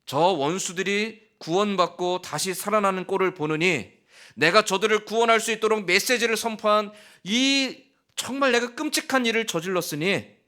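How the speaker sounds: high, loud despairing protest; anguished outcry. Acted. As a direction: cry out in bitter anguish. Jonah begs God to die.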